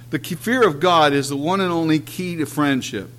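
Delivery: usual speaking voice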